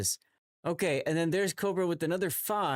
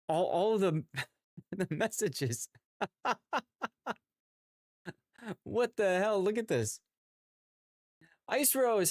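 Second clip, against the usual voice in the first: normal talking voice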